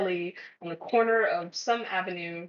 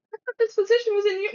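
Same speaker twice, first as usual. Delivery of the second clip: much higher, louder and fast with a laugh; shocked disbelief; 13 semitones higher, 6 dB louder, much faster than usual. Retold or imitated. Employee reading the wrong city in the offer, shocked. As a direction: blurt it out in stunned disbelief.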